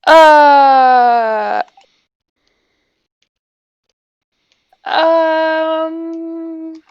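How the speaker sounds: long drawn-out filler sounds with long pauses; stall, holding each sound out; stalling hesitation. Acted. Player stuck for where the word comes from, stalling. Stalling on an awkward in-game question.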